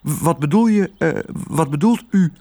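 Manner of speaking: put-on high, hesitant girl's voice; nervous stammer. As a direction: stumble and correct yourself timidly. Girl stammers before threatening dog.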